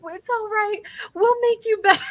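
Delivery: very high-pitched, gushing comfort; mock-sobbing reassurance. Women promise to fix the visitor, hammed-up tearful comfort. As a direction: console through pretend tears, voice way up high. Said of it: "some of them are just sobbing over you".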